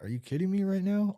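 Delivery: high, incredulous exclamation; can't believe what he's seeing; disbelief. Retold or imitated. Finding a Bible in the road, high incredulous disbelief. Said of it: "And I'm like".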